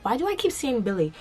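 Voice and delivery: normal talking voice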